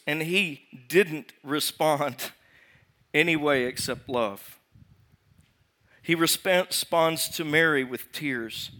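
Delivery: normal talking voice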